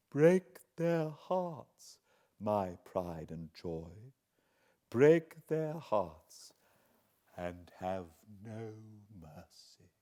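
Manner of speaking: put-on slow, drawn-out old woman's murmur, pressing on break; murmured urging. A narrator's reading. Miss Havisham murmuring to Estella, slow and insistent. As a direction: breathe it into her ear like a vow. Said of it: "murmuring something in her ear".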